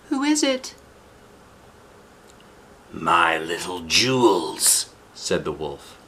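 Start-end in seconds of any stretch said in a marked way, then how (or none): 0.1-0.7 put-on squeaky child calling through a door; high small voice, wary; high little girl voice. Eldest daughter asks who knocks, in a high child voice. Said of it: "said through the latched door". 2.9-4.9 sly wolf voice. Acted. Wolf pretending to be grandmother, slow sly croon.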